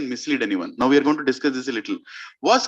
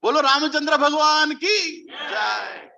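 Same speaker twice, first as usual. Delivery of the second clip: high, jubilant cheer, drawn out; very happy; 9 semitones higher, slower than usual. Acted. Kaikeyi hears Ram will be crowned, very happy. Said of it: "She was very happy".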